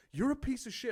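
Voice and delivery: high, angry insult; retold freak-out. Comic insults front-row heckler, high retold anger. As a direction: re-enact hurling abuse at a heckler. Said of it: "had a little freak out on stage".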